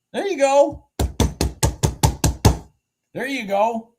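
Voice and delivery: high, loud cheer. Real, not acted. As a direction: shout it in delight. Repeated cheer fading down.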